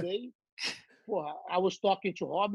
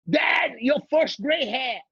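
slow, loud, higher call; excited daughter; 5 semitones higher, 3 dB louder, much slower than usual. Retold or imitated. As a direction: announce it like big news. Daughter announces gray hair.

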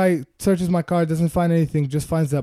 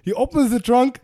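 normal talking voice

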